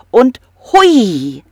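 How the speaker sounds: high, loud hui; excited whee. Acted. Narrator cheers the kobold's escape downstream, high and excited. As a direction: let it fly with joy.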